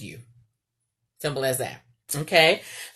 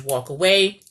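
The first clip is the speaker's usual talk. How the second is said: ordinary talk, nothing marked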